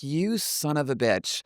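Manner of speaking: slow, soft mock resentment; retold grudging curse. Wrestler leaving after surprise elimination, retold grudging curse. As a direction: draw the curse out under your breath.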